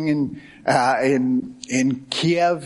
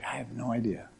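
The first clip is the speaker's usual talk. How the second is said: a much softer, offhand I have no idea; quiet wife's reply; 3 semitones lower, 13 dB quieter than usual. Retold or imitated. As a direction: drop the voice right down for the wife.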